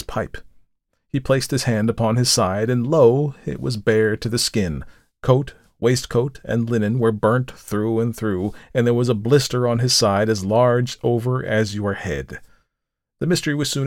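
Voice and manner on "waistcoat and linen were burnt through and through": ordinary delivery, nothing marked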